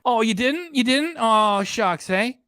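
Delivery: high, sarcastic fake surprise; mock sympathy. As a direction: tease with exaggerated, high-pitched disbelief. Mocking the clip's apology.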